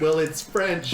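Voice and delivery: high, loud mock kid excuse. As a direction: play the kid blaming French. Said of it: "Are you stuck on that word?".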